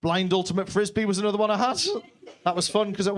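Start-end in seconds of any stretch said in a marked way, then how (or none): none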